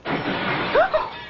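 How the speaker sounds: sharp high gasp of fright; startled gasp. Acted. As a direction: catch your breath in shock. Sudden crash from the bedroom.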